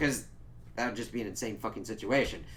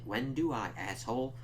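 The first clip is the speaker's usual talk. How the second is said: ordinary talk, nothing marked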